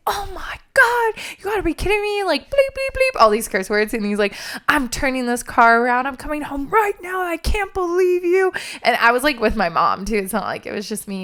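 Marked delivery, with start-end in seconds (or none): 0.1-8.5 heated dad rant. Retold or imitated. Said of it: "he's getting really heated".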